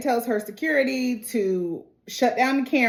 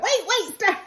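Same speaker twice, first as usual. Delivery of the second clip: alarmed; 11 semitones higher than usual. Retold or imitated. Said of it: "so many times I was like".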